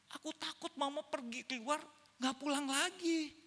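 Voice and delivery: put-on high-pitched little-boy voice, slowing at the end; scared child. Son telling mother his fear, scared child. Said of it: "Anaknya ngomong gini".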